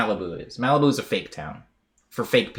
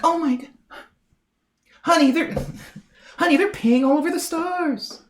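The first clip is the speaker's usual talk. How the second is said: very high, breathless mock mother; imitating a shocked mom. Mom shushing her child about the man, high mock horror. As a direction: gasp it out like a scandalized mom, laughing.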